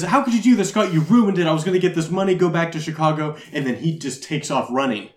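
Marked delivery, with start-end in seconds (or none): none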